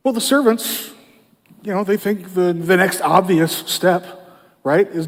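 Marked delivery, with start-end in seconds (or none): none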